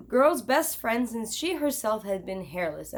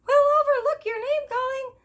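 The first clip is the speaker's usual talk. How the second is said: very high, louder squeaky royal decree; high mouse king proclaiming; Mouse King announcing; 6 dB louder than usual. Acted. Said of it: "the Mouse King announced".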